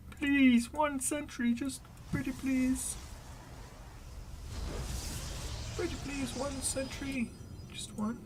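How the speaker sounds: high, soft, whiny pretty-please plea; mock begging. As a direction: beg like a child for one sentry. Begging the player for sentry.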